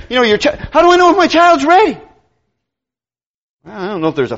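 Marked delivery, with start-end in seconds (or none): 0.7-2.0 higher, louder anxious asking; worried parent. Retold or imitated. A parent asking the preacher, higher and anxious. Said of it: "I often told parents".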